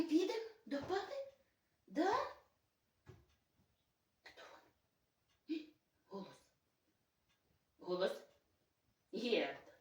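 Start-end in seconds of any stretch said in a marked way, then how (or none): 4.2-9.7 soft, low coaxing for a bark; quiet prompting. Real, not acted. Mother quietly asks the dog Герд to bark. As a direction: hush and ask the dog to speak. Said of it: "Скажи голос".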